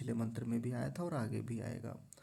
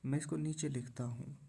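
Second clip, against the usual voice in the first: ordinary talk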